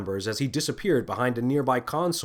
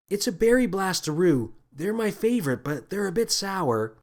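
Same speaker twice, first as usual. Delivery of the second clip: put-on girl voice; 7 semitones higher than usual. A narrator's reading. Lily offers candy.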